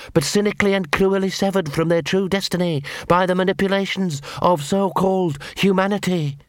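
high, reciting poodle voice; fanatical poodle. Acted. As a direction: recite doctrine high, as if quoting.

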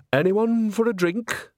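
high, slow, sing-song offer; bright hostess chirp; cheery old woman voice. A narrator's reading. Nanny Ogg offers drinks.

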